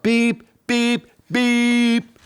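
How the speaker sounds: high beeping horn noise; playful high truck horn; horn beeps. Acted. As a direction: beep like a big rig's horn. Truck horn in picture book.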